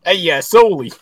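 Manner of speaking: loud Boston guy greeting his buddy; Boston accent. Pretend. Greeting Sully as a Boston local, a lightly put-on Boston accent. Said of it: "I'm from Boston".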